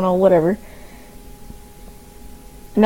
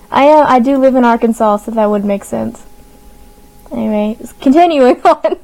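normal talking voice

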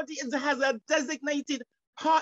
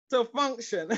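words tipping over into a laugh; real laughter. Real, not acted. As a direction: finish the phrase and burst out laughing. Breaks into laughter while preaching.

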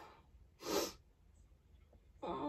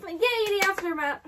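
higher, louder little cheer; 5 semitones higher, 5 dB louder than usual. Real, not acted. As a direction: cheer the word "aftermath" with a yay. Cheers the same title.

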